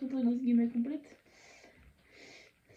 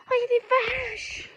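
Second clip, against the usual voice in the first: excited; 11 semitones higher than usual. Real, not acted. Spots cows while walking.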